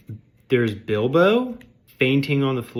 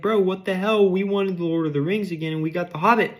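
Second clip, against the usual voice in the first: higher, louder complaining fan, a bit fast; mock whiny boomer fan; 6 semitones higher, 3 dB louder than usual. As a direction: nag like a let-down fan. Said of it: "pressuring this guy like".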